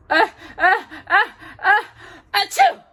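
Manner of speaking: high rising sneeze build-up; high loud sneeze build then achoo. A narrator's reading. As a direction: work up to a big sneeze.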